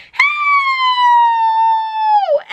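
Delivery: high piercing scream for help; mock terrified yell for help. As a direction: shriek it out in panic. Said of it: "he goes".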